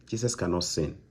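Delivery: usual speaking voice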